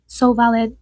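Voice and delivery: ordinary talk, nothing marked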